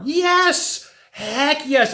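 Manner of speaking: a high, bright burst of agreement; emphatic yes. Acted. Answering a listener's doubt with an eager, high yes.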